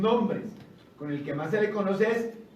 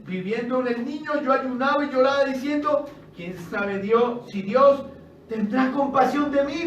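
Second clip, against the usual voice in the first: pleading answer; 6 semitones higher, 7 dB louder than usual. Acted. David answers, read with force.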